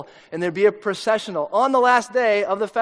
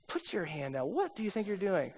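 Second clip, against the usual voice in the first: inner voice; 11 dB quieter than usual. Retold or imitated. Nicodemus scolding himself in his head, much softer. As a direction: mutter to yourself in alarm. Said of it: "in his head he's going".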